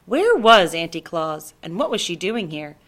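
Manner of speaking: plain reading voice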